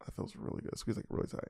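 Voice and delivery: very soft, whispered pleasure; intimate whisper. Pretend. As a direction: whisper it close, savouring the squeeze. Hand squeeze, murmured aside.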